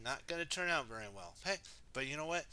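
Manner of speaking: plain reading voice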